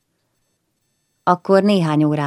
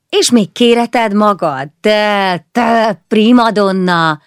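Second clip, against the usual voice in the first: slow, louder mock scolding of a diva; teasing Péter; 5 semitones higher, 6 dB louder, slower than usual. Péter teasing Bora into performing, slow mock scold. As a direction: tease her like a grand impresario.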